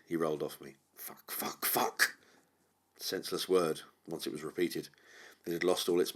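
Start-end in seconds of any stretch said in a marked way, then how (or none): 1.0-2.2 exasperated. A narrator's reading.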